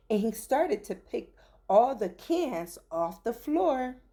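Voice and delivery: usual speaking voice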